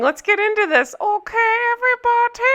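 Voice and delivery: very high mock European accent; Anna's accent. Recapper rallying viewers to start, high mock accent. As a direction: sign on in a high, exaggerated German-ish accent. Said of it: "I promise I'll stop".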